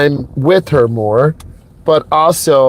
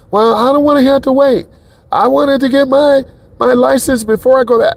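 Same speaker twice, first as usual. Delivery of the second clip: put-on much higher, whiny young girl's voice, a touch fast; mimicking the upset girl; 9 semitones higher than usual. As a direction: play the teenage girl whining about waiting. Voicing Brooklyn crying about waiting.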